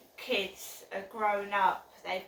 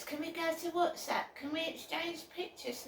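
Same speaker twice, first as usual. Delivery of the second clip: higher pushy pleading voice, imitating texters; mock pleading; 8 semitones higher, 3 dB quieter than usual. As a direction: whine it like a nagging stranger. Mimicking the pushy texters' requests.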